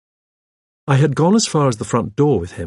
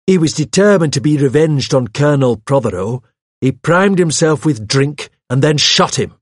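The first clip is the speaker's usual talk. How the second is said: higher, louder, emphatic accusation; heated insistence; 5 semitones higher, 5 dB louder than usual. Acted. Hawes insists on the suspect's guilt, heatedly. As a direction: drive the theory home forcefully.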